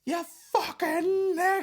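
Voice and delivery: high, slow mock yell of an attacker. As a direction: hurl it like the chaser shouting after him. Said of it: "And he went".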